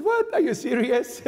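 shocked "what", then doubting; a priest who can't believe the old folks' offer; incredulous. Retold or imitated. Priest's disbelief at elderly volunteers.

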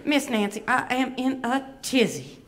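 flustered Atlanta Southern belle; Southern puppet voice in a tizzy; Miss Bevy. Acted. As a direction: fuss with a put-on Southern accent. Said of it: "she does have a bit of an accent".

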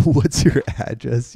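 loud, slow, laughing question. Real, not acted. Streamer reads out an intrusive chat question, laughing. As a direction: burst out laughing through the words.

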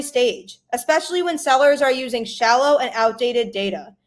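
upbeat sponsor read. Acted. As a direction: read it like a radio ad. Host reads sponsor ad.

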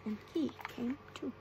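ordinary delivery, nothing marked

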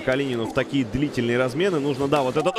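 ordinary talk, nothing marked

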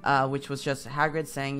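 ordinary delivery

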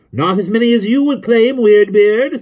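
high, loud bickering comeback; sharp retort. A narrator's reading. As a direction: throw the insult back at full voice.

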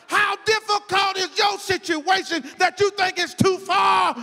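loud, high, driving sermon delivery; full-force preaching. Real, not acted. Preacher urging congregation that Jesus can handle it, full-force preaching.

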